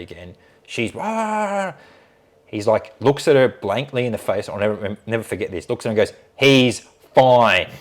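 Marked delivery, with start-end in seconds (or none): 1.0-1.7 high, drawn-out dreamy "ah"; mock swooning sigh. Nurse swoons as he tells the story, mock sigh. 6.4-7.7 loud, emphatic surgeon's verdict; very stern. Retold or imitated. Surgeon rebukes a fawning nurse, imitated stern voice. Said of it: "very stern with her at this point".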